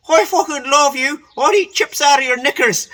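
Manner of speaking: imitating a drunk mate's crude devotion; drunk declaration of love. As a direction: bellow it up at her, besotted. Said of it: "Biddy goes".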